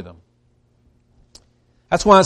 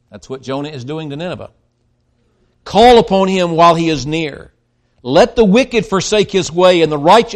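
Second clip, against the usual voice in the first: scripture reading; 4 dB louder than usual. Acted. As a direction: proclaim the verses to the congregation. Reads Isaiah aloud.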